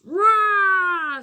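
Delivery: loud, high, falling cry; exclamation de Nino Dino. A narrator's reading. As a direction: shout like an excited little dinosaur. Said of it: "s'exclame Nino Dino". (grand cri de joie aigu)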